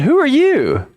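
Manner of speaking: mock high echo. Man asks back, high.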